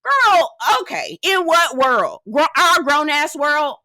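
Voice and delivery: high, loud, slow disbelief; outraged shout. Real, not acted. Reacting to Cal excusing the fight, outraged shout. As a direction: cry out in disbelief.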